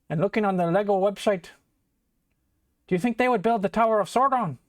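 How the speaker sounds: mock Scottish Samwise urging on the builder. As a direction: play Samwise as a cheery Scotsman. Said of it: "maybe we'll just do Samwise if he was Scottish".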